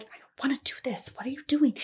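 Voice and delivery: quick, hushed retold plea; retold protest. Telling cohost how she fought him, hushed protest.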